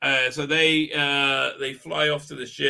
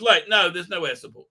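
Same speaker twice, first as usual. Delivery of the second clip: ordinary talk, nothing marked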